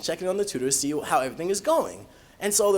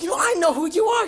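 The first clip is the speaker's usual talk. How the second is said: high, louder mock excitement; excited grandmother; 7 dB louder than usual. Grandmother finally meeting her grandson's teacher, excited. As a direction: gush like a thrilled grandmother. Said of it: "She's, like, so excited".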